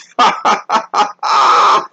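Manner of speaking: breathy, rattling burst of laughter. Real, not acted. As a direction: laugh it out at your own joke. Laughs at own joke.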